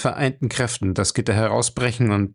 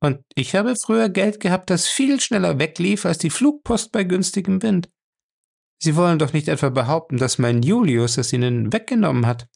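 higher, brisk woman's voice, mildly sardonic; Fay's voice; 7 semitones higher than usual. A narrator's reading. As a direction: tease him lightly as Fay. Narrator voices Fay.

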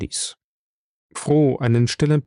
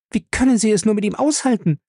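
entsetzte junge Frau; 10 semitones higher, faster than usual. Acted. Ella entsetzt über den Chef.

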